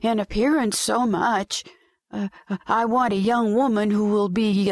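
put-on high, stammering sweet old lady; sweet and gentle. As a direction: coo it like a kind grandmother. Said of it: "in a sweet and gentle voice".

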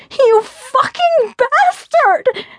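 high-pitched, drawn-out angry yell; furious yell. A narrator's reading. Fiona to Gabe as he pulls her along, furious yell. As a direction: yell it slowly at the top of the voice. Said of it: "I yelled as he pulled me along".

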